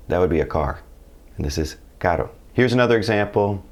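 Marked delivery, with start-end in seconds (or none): 2.0-2.3 "caro" with a quick single-tap R; tap, don't roll, the R in "caro"; soft tapped R. Shown as a demonstration. Teacher returns to caro after carro, tapped R. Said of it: "more like a tap on the roof".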